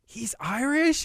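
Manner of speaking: high, amazed double-take; surprised question. Retold or imitated. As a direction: squeak out the surprise like the intern. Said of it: "and he said".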